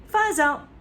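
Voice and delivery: put-on proud boy announcing; proudly. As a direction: announce it triumphantly. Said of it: "George announced proudly".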